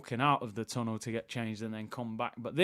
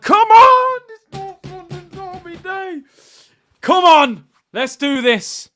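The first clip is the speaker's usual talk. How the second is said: very high, full-force fan shout; roaring rally cry; 18 dB louder, slower than usual. Real, not acted. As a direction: bellow to fire up the supporters. Bursts into derby-day rallying cry.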